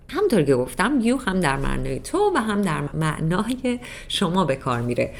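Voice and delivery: normal talking voice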